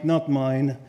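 ordinary talk

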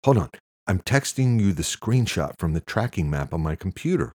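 agitated caller giving updates; manic. A narrator's reading. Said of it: "He sounded manic and out of breath".

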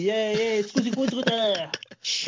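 mock Italian, overplayed for laughs; fake Italian gibberish. Repeating the fake Italian bit.

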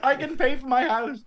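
high, loud mock plea; desperate pedestrian. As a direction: imitate someone crying out for a payout. Voicing the insurance-seeking pedestrian.

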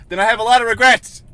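high, loud frustrated outburst; screaming. Real, not acted. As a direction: scream it out in exasperation. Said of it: "I'm sorry for screaming".

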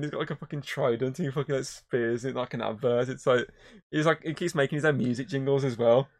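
usual speaking voice

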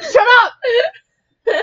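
loud, high shushing outburst; embarrassed shout. Real, not acted. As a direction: yell to silence a teasing friend.

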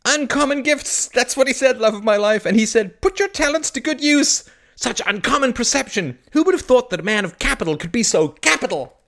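high, loud, exultant proclamation; jubilant. A narrator's reading. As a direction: crow triumphantly to your beloved. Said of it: "caught Kay in his arms and danced her around".

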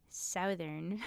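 usual speaking voice

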